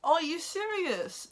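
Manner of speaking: high, loud, slow disbelief; outraged at Harden's bet, slow and incredulous. Retold or imitated. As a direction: ask it as if it can't be true. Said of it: "I was like".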